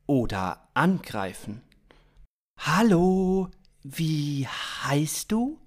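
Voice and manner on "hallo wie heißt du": very slow, drawn-out question; careful addressing. Acted. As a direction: talk to someone who may not understand. Carefully addressing an alien in German.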